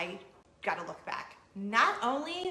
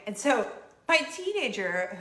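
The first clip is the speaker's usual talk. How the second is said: usual speaking voice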